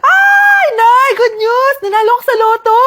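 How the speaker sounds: high, loud squeal of excitement; ecstatic announcement. Acted. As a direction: shout the big win to mother. Acted lottery win announced to mother. (tuwang-tuwang sigaw)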